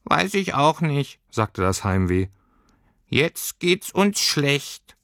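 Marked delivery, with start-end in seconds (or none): 0.0-1.2 slow, higher, a touch lost; little creature shrugging; lightly high, slow and puzzled; helpless creature. Acted. Heimweh voice. 3.1-5.0 high, very slow, gloomy; little creature sighing over its bad luck; drawn-out high mope; glum creature. Heimweh voice.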